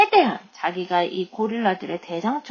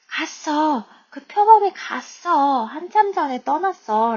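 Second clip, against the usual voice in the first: higher-pitched, emphatic quote; acted Annie line; 7 semitones higher, 4 dB louder, slower than usual. Teacher voices Annie telling Jack of the leopard, higher pitch. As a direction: voice the girl's news higher than usual.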